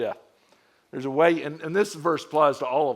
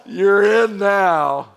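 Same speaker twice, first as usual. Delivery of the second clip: Die Hard mode; 3 semitones higher, 10 dB louder, much slower than usual. Retold or imitated. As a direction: draw it out like an action hero. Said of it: "in Die Hard mode".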